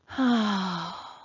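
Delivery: long tired exhale; weary sigh. A narrator's reading. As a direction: sigh out old Pat's worry. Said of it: "Old Pat sighed".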